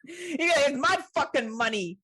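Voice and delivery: high, loud jokey Australian tough-guy voice; a hammed-up Australian accent; mock Aussie. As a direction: play an Aussie brawler for laughs. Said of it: "He's an Aussie, though".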